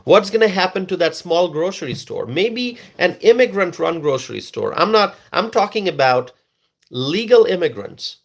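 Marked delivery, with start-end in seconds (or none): none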